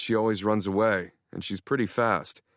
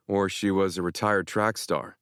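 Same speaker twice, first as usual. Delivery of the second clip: plain reading voice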